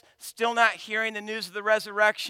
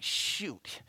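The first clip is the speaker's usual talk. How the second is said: 1 whispered shoot; 4 semitones lower, 9 dB quieter than usual. Retold or imitated. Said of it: "I'm like".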